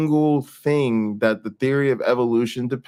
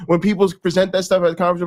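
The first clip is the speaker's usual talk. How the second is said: ordinary talk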